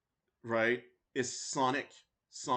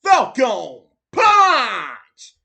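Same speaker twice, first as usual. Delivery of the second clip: "Falcon Punch" roared very slow, much louder; Falcon Punch impression; 7 semitones higher, 14 dB louder, much slower than usual. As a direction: stretch and bellow "Falcon Punch". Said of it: "I have to do it".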